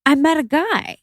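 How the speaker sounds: high-pitched mom announcing news; imitated mom. Daughter retells mom announcing a new man, imitated mom. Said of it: "And she's like".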